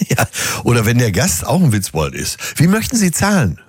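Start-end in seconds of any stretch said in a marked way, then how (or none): 2.6-3.6 high-pitched, polite waiter voice; put-on waiter, pitched up; waiter's question. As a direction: ask it high, like serving staff. Waiter's question in acted exchange.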